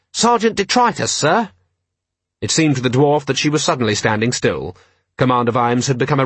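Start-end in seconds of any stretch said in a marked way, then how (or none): 0.1-1.5 high-pitched officer reporting to a superior; reporting subordinate. Acted. Subordinate answering the commander, high-pitched report.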